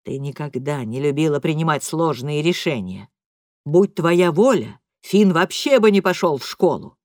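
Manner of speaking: cold, cutting dad; father scolding mother, low and hard; low angry father. A narrator's reading.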